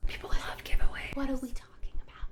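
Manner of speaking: unvoiced whisper to himself; whispered aside. [name], sitting off to the side, whispers his confusion to himself. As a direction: mutter it under your breath in disbelief. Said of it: "[name]'s just sitting there".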